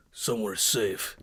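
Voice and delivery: low, soft, slow reassurance; Viking voice. Pretend. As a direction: promise safety in a deep gentle rumble.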